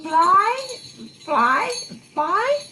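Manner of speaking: high, loud call to fly. Acted. Mrs Claus urges the reindeer on, high excited call. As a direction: cry out eagerly, fly fly fly.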